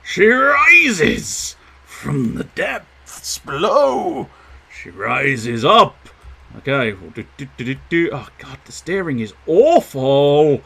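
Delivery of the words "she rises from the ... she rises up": slow, grand, higher movie-trailer voice; dramatic narrator. Pretend. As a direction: announce it like an epic. Dramatic narration of flyer rising.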